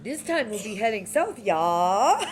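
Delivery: mock Swedish, cracking into a laughing shout; hammed-up Swedish announcement breaking into laughter; Swedish accent as Inga. Announcing the ride route in a mock Swedish accent, laughing. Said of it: "speak Inga language".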